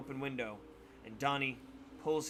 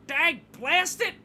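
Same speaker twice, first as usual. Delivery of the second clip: put-on slow, high, loud old-man curse; hammed-up old coot yelling; old man shouting after a kick to the shin; 11 dB louder, slower than usual. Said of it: "the man shouts".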